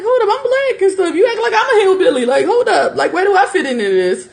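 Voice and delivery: high, laughing hold-up disbelief; mock indignant. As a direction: squeal it out like you can't believe it. Mocking reply to hillbilly insult.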